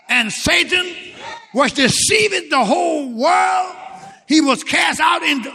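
fiery preaching. Acted. Preaching Revelation reading.

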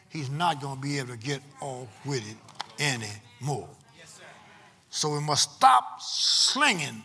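deliberate pulpit delivery in weighted phrases; emphatic preaching. Real, not acted. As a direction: preach the point home, punching key words and pausing between phrases. Resumes preaching the point.

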